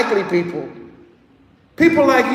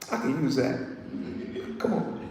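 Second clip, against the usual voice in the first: low, soft, knowing approval; quiet approval; 9 semitones lower, 13 dB quieter than usual. Retold or imitated. Yeshua sizing up the zealot, said low and soft. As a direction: savour it quietly.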